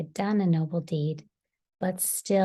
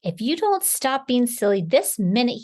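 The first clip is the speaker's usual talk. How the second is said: loud, raised wolf roar; 6 semitones higher, 7 dB louder than usual. A narrator's reading. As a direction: roar a cross threat. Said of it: "he roared".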